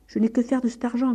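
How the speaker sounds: understated indignant outburst; mild cry. A narrator's reading. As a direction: reject it with a restrained shout. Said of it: "cria le père".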